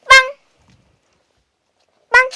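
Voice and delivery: high loud bang shout. Real, not acted. Shouted command to dog.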